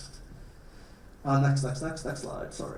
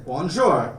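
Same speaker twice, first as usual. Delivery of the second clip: French hello, taught. Shown as a demonstration. Teacher models "Bonjour" for students to repeat, in French. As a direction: model "Bonjour" for the class to repeat, first take. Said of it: "I'm gonna be a French teacher".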